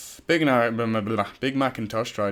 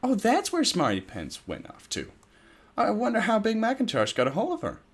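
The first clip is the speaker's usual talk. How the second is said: a lightly higher Twilight voice; gently wondering, a bit higher; 12 semitones higher than usual. Acted. Twilight wondering aloud to the others, lightly voiced higher. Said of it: "This is gonna be the last try".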